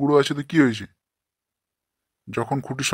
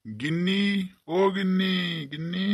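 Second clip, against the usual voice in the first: calling out; 6 semitones higher, 4 dB quieter, slower than usual. Acted. Husband calls wife from outside.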